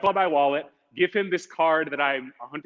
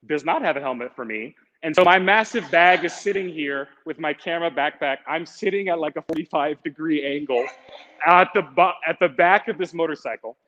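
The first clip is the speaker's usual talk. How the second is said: ordinary talk, nothing marked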